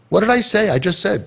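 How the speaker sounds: high-pitched, put-on defensive question; mock innocent protest. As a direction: imitate someone feigning innocence. Mimics defensive adults who reject kids.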